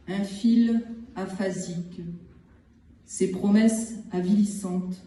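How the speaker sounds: measured poem recital with long pauses between phrases; poetry reading. Acted. Poet reads short poems from the collection to listeners, measured recital. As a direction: set each short phrase apart, letting silence follow.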